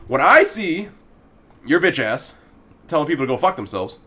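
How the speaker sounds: ordinary talk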